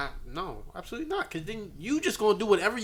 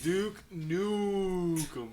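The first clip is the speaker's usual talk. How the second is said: slow mock announcer; 8 dB quieter, much slower than usual.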